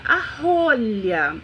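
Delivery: a rolha said very slowly, rolha stretched; drawn out for child. Shown as a demonstration. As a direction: stretch a rolha for the child to hear the first sound. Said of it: "falar pra criança".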